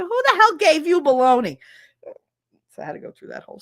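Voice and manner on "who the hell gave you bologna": high, loud indignant demand; imitating an angry, raised-voice interrogation; outraged grandma. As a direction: explode like a scandalized grandmother. Outraged grandma in retold quarrel.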